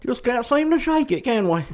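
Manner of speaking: high-pitched, badly faked British accent. Co-host playing along with the host's accent, bad British accent. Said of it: "that was a very bad British accent".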